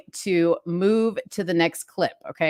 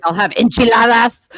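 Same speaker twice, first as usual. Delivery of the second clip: imitating husband's gringo Spanish, louder; a husband ordering with a put-on accent; 4 semitones higher, 8 dB louder than usual.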